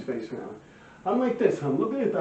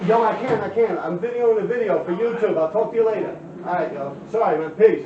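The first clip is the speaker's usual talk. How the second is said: louder, calling to someone outside; calling out the window; 4 semitones higher, 5 dB louder than usual. Real, not acted. Telling a neighbor at the window he's filming, called out. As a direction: brush them off from the window. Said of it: "I got someone yelling at my window".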